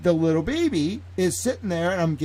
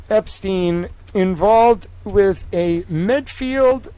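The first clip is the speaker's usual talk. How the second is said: reading aloud; 6 semitones higher, 5 dB louder, much slower than usual. Real, not acted. Reading a news headline aloud.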